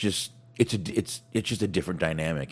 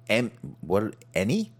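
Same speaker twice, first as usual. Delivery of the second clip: normal talking voice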